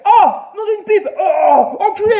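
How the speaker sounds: high, loud cry of pain then swearing; hammed-up cry of pain and anger; pained shout. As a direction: yell as if burned. Man's hand bursts into flames.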